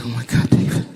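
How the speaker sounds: breathy imitation of a tearful wife; mock sob it out, voice thinned; retold crying. Crying wife to her husband in the hotel room, mock tearful. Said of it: "And she's crying".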